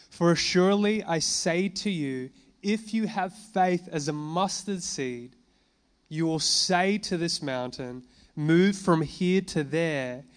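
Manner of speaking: slow, weighty reading of the Lord's words; slow, grave sermon reading of scripture; solemn scripture reading. Acted. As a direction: read it as the voice of authority. Reads Jesus's words as scripture.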